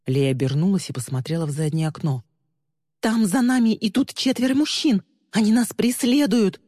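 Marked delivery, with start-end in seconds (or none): 3.0-6.6 high-pitched panicked shout; alarmed cry. A narrator's reading. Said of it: "закричала она". (испуганный крик)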